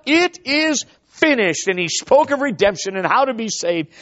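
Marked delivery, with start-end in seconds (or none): 0.0-1.7 It is finished drawn out high and slow as a cry; Savior's triumphant cry from the cross; cried out. Retold or imitated. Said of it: "cried out".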